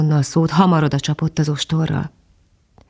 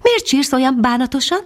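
very high, tiny chirping voice; a little bird calling down sweetly; high tiny titmouse voice; silvery little bird voice; 8 semitones higher than usual. A narrator's reading. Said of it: "ezüstös hangocska".

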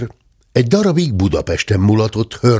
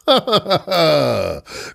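a short acted laugh; amused chuckle; hearty laugh; 6 semitones higher than usual. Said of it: "kacagott Dörmögő úr".

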